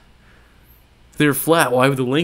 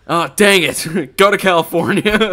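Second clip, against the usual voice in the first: louder, laughing through the words; 6 semitones higher, 6 dB louder than usual. Real, not acted. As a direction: laugh it off while saying it. Caught out, laughing at himself.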